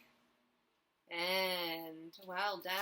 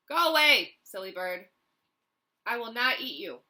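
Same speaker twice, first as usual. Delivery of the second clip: go away; 4 semitones higher, 11 dB louder than usual. Acted. Dragon shoos the bird.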